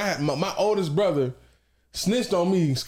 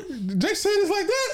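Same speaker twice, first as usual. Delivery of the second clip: drawn-out, high-pitched mock reading; 11 semitones higher, slower than usual. Friend reading his texts back in disbelief, high-pitched. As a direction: read it slowly in an exaggerated high voice. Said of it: "a high-pitched voice".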